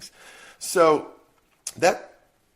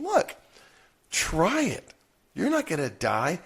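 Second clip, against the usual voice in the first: coaxing parent; 6 semitones higher than usual. Retold or imitated. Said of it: "observing and saying".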